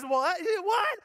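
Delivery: imitating baffled wife. Wife baffled by husband's order, rising incredulous mock cry. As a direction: escalate to a high mock yelp. Wife's disbelief, retold.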